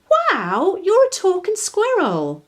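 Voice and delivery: high, loud delighted wonder; girl delighted by a talking squirrel; amazed. Acted. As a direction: marvel at the squirrel. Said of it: "exclaimed Daisy".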